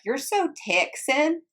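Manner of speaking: high, slow teasing drawl; mocking coworkers. Coworkers at the office teasing her, mocking high drawl. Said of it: "they were like".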